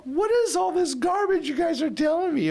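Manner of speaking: high-pitched indignant protest; exasperated outburst. Retold or imitated. Angry student throwing back pat answers about God's will, high-pitched exasperation. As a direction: retell it as an incredulous, high outburst.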